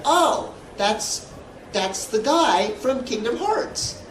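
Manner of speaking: high, halting mock amazement; retold starstruck discovery; imitated startled recognition. Acting wife's startled recognition online.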